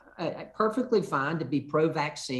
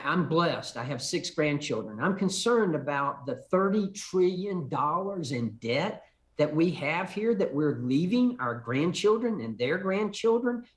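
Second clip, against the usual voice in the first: ordinary talk, nothing marked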